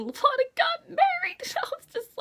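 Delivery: high, whiny fan wail; mock wail. Real, not acted. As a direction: wail it in fake despair. Upset reaction to wedding.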